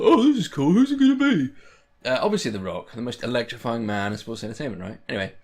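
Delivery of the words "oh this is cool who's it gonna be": much higher, louder mock excitement; hammed-up eager voice, much higher; giddy fan. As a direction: squeal like a thrilled fan. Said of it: "I was just like".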